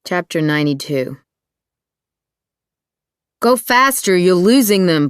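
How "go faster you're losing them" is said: high, loud urgent command; barked order. A narrator's reading. Back-seat passenger urging the driver in a car chase, barked. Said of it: "barked Elise Joyce from the back seat".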